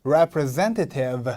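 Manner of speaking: very slow "Representative" with the third syllable stressed; stress on ZEN. Shown as a demonstration. Teacher models for repeating, stressed "zen" in "Representative". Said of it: "여기에 강세가 옵니다".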